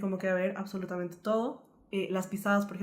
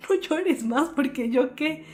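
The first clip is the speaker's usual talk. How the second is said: higher, louder plea to stop crying; pleading retold; 7 semitones higher, 7 dB louder, much slower than usual. To her crying friend on the phone, loud higher plea.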